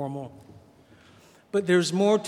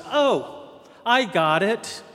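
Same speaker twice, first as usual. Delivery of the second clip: higher and louder, a sudden triumphant answer; eager realization; 6 semitones higher, 7 dB louder than usual. Retold or imitated. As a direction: Peter blurting out the answer he finally sees. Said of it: "Until finally Peter goes".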